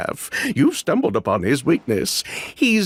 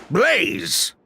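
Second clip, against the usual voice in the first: calling out; 10 semitones higher than usual. Acted. Sister calls her brother over.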